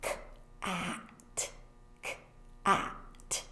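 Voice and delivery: "Cat" whispered and drawn out slowly; slow whispered demo word; turtle-slow word. Shown as a demonstration. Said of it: "So you would say like this".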